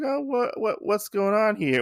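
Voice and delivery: high, startled what; surprised. Retold or imitated. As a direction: gasp up at the tower in surprise. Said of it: "and he's like".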